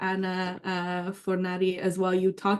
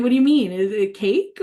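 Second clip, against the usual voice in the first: mock-baffled boyfriend, pitched up and loud; boyfriend's confused surprise; 2 semitones higher, 7 dB louder than usual. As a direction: play the puzzled Italian boyfriend asking what she means. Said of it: "He was like".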